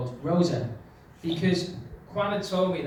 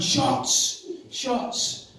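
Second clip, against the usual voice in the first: higher, clipped order of shots; commanding; 6 semitones higher than usual. Retold or imitated. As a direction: make it an order. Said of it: "turns into a command".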